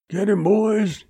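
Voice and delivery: high, half-yelled command to the gang; narrator's contained yell; yelled order. A narrator's reading. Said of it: "he yelled".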